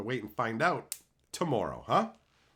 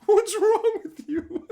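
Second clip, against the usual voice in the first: very high, louder, slow, cracking up; laughing disbelief; 9 dB louder, slower than usual. Retold or imitated. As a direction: laugh through the question. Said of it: "shaking their heads like".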